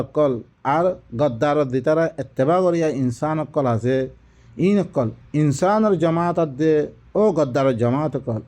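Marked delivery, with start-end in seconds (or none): none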